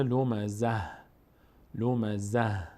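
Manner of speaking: slow لمزه ending in a breath of h, no t; pausal h. Shown as a demonstration. (وقف روی «لمزه» با های ساکن به جای تا)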